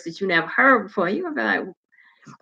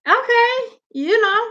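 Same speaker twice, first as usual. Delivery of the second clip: high-pitched put-on voice; imitated attractive voice; 14 semitones higher than usual. Host imitating how some people's voices sound, high-pitched put-on voice. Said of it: "their voices are like".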